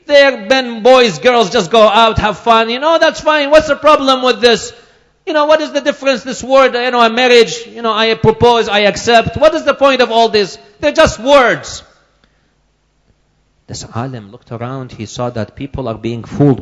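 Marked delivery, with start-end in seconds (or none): 0.1-11.8 high, loud dismissive voice; mock the educated man's scorn; arrogant doctor. Preacher voicing the doctor scoffing at the scholar, high and loud. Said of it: "he talks to this alim and he says".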